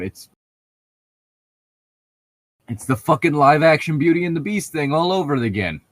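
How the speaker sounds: normal talking voice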